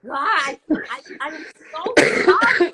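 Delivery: loud, high hammed-up wail; mock wailing apology. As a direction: overact a tearful apology.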